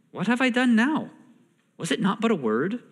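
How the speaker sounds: lightly acted defensive question; protesting. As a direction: answer back like a wronged little brother. Younger brother protests the scolding.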